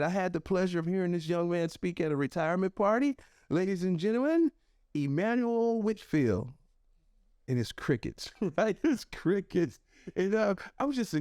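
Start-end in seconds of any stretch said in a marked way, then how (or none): 0.0-6.5 higher imitated emcee, drawing out the name; announcer introduction. Professor presenting young man to teachers, imitated announcer. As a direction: build up to presenting the next speaker. Acted host announcing him to the crowd.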